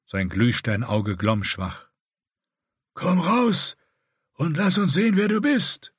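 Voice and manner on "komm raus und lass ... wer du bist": calling out rasping. A narrator's reading. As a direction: call him out of the dark, grating. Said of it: "rief er".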